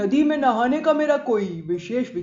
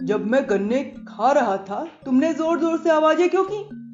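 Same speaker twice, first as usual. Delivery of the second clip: reproachful questioning in a put-on voice; heated scolding, building in the questions; agitated. A narrator's reading. Bhaskar confronts Kant over the betrayal, agitated. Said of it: "Bhaskar ने उत्तेजित होते हुए पूछा".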